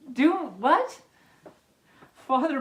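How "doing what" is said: high, loud disbelieving question; incredulous. Real, not acted. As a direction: throw up the pitch in shock. Reader baffled by Ralph.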